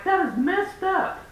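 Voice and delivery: telling off the Bible guy; disgusted rebuke. Retold or imitated. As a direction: let the disgust ring out. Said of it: "And then I'm like".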